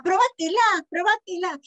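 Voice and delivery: high, happy insistence; delighted urging. A narrator's reading. Mum thrilled by the gift, high delighted urging. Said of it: "se puso de contenta".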